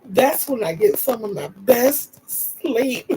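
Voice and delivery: drawn-out words breaking into laughter; helplessly amused at yourself; real laughter. Real, not acted. Laughing at herself.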